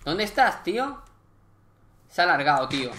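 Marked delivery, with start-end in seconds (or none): 0.0-1.0 high, loud calling out; frustrated call. Real, not acted. Gamer shouting after a fled Enderman, frustrated call. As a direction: call out for the lost mob.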